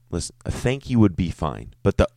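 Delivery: ordinary talk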